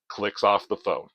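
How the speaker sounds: normal talking voice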